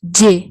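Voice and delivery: teacher modelling جے as the Turkish letter c; Turkish letter name. Shown as a demonstration. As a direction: say جے as Turkish c, like jay. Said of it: "اس کو ہم جے بولتے ہیں".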